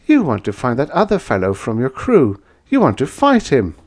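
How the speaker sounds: a knowing woman sizing him up; woman's voice. Acted. Woman guesses his purpose.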